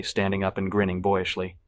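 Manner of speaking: plain reading voice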